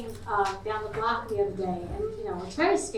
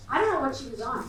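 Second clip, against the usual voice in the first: usual speaking voice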